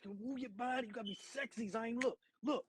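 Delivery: high, hushed mock coaching; imitating Uncle Jesse. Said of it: "He's like".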